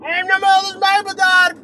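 high holler, laughing afterwards; playful shouting. Pretend. Driver yelling the store's name out of the car for fun, shouting. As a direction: yell it out like a silly passing call. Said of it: "Ik schreeuw het".

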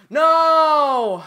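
high, very loud cry of defeat; anguished shout. Real, not acted. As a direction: yell it out at losing. Killed near goal in game.

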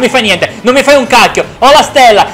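excited battle shouting. Real, not acted. Gamer chasing a rival with a star, frantic excitement. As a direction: yell through the fight.

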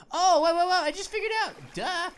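sudden loud realization; excited. Real, not acted. Sudden realization mid-game.

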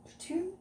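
Poutine? asked high and soft with a rise; a high, soft, doubtful Poutine?; unsure high poutine. Shown as a demonstration. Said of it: "I don't know how you say it".

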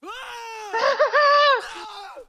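high shrill cackling scream; shrieking laugh. Acted. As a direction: cackle like something mad. Creature screams beneath floor.

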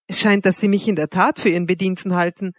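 usual speaking voice

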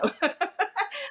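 a quick high giggle; a short rising laugh; bright laugh. Real, not acted. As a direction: laugh at your own story. Laughing while telling the story.

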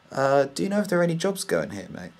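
mock-friendly shopper asking about jobs; retold polite ask. Retelling asking staff about jobs.

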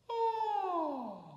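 high falsetto yawn sigh. Shown as a demonstration. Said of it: "that's called a yawn sigh".

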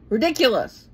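mock critic sneering ridiculous; scornful ridiculous.

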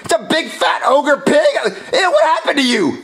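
high disgusted yelling; recoil, shouting in horror; disgusted shock. Acted. Kiss turns her into ogre.

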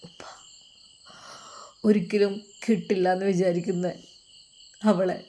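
halting, subdued tearful voice; tearful son. A narrator's reading. Son confiding in his father about the girl, tearful. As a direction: hesitate through held-back tears. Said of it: "എന്താണ് ഇങ്ങനെ കരയുന്നത്".

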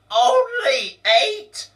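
very high, loud, slow screech on "Only eight?" rising. Acted. Boss repeating Donald's words, screeching loud. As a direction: shriek the words in disbelief. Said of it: "screeched Rob O'Glower".

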